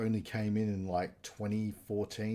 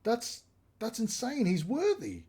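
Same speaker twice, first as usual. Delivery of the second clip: high, amazed outburst; stunned disbelief; 10 semitones higher than usual. Retold or imitated. Thor reacting to the hammer being caught, stunned high voice. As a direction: gasp it out like you can't believe your eyes. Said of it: "And Thor's just like".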